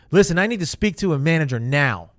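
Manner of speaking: loud, demanding caller; angry customer. Retold or imitated. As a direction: bark it at the rep like an irate customer. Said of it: "if they sound like they're upset or mad".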